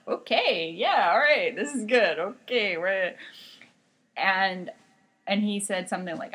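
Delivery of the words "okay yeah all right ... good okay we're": awkward interpreting. Retold or imitated. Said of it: "I'm just standing there interpreting it".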